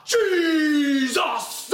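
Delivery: high, hugely stretched revival shout; hammed-up pulpit cry with drawn-out vowels; mock shouting preacher. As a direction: holler it like an over-the-top evangelist. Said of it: "Or the others".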